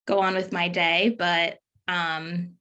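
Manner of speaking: ordinary delivery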